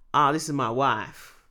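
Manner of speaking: lightly put-on Southern US accent; southern American accent. Talk-show guest introducing his wife, mock Southern accent. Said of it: "why have you got a southern accent?".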